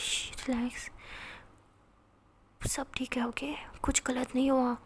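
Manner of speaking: soft, very hushed reassurance; tender whisper. A narrator's reading. Teacher calming a sobbing student, tender whisper. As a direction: barely breathe the words to calm her. Comforting crying student after kiss.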